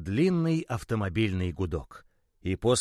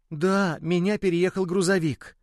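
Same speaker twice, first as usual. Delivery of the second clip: sad admission; 7 semitones higher, 4 dB louder than usual. Acted. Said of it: "грустно подтвердил мячик".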